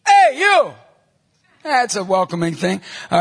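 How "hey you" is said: high, loud holler across the street; calling out. Retold or imitated. Calling over to two kids across the street, loud shout. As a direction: bellow it to someone far off. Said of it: "so I call over".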